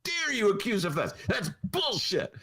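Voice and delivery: high, slowed mock outrage; mock indignant shout, pitched high and drawn out; mock mayor's outrage. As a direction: ham up the mayor scolding a reporter. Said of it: "And Lori Lightfoot goes".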